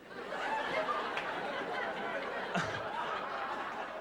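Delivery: high breathless cackle at his own joke; breathy high laugh. Real, not acted. Comic laughing at his own cat joke, high breathless laugh.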